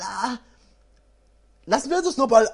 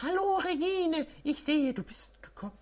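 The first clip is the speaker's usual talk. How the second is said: higher, light greeting; friendly voice; 8 semitones higher, 5 dB quieter than usual. Acted. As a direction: greet her kindly. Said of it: "hörte eine Stimme freundlich sagen".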